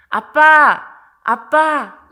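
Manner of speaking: very loud call for Dad; imitated child's loud call. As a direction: shout for your father like a young son. Son calls his father again.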